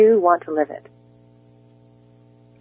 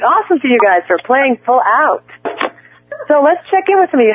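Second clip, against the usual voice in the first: ordinary talk, nothing marked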